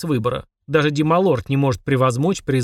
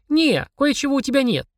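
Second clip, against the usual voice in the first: put-on high, sly girlish voice; sly child; 12 semitones higher than usual. A narrator's reading. Girl outwitting the demon, high sly voice. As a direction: tease slyly as a little girl. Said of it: "хитро прищурилась Полина".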